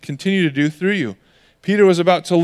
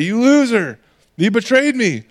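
higher, indignant mock scolding; mock angry accusation; 6 semitones higher than usual. Imagined Jesus confronting Peter after the grave, mock angry. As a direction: act out an outraged accusation, pitched up.

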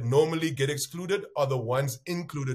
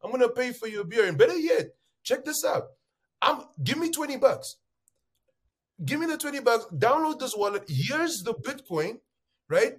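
higher, animated walkthrough; lively retelling of showing a skeptic the app; eager demonstrator; 8 semitones higher than usual. Retold or imitated. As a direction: talk him through it with gusto, pitched up. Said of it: "I'm like".

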